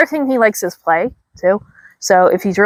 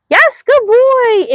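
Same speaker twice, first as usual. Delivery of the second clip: high, slow, excited dog praise; 12 semitones higher, much slower than usual. Real, not acted. Trainer rewards her dog for slack leash, high excited praise. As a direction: stretch out a bright yes for the dog.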